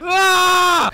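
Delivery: high, loud battle shriek; hammed-up angry scream; mock rage scream. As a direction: scream as you lunge in a game. Mock rage, attacking friend.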